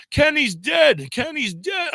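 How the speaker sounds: high, panicked cry; a scared kid shouting the worst news; terrified shouting. Retold or imitated. Boy in the back yelling to the driver, high and terrified. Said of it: "he said".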